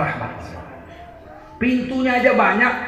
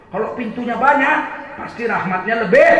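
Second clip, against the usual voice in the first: louder, building to a shout on lebih; preaching emphasis; 2 semitones higher, 4 dB louder than usual. Real, not acted. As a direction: drive the point home to the crowd. Preacher punchline rising to shout. (penekanan khotbah yang lantang)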